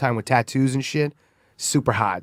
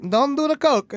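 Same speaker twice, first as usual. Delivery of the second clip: imitating a scolding grandmother, pitched way up; grandma voice; 13 semitones higher than usual. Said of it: "your grandma would say".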